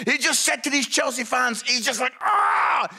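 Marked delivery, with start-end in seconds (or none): 2.2-2.9 high-pitched mock yell; retold scream. Friend screaming through the fence at Chelsea fans, mock scream. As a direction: let out a hammed-up cry. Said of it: "he screamed through the fence".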